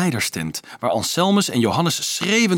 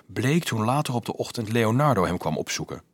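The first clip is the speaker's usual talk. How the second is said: ordinary delivery, nothing marked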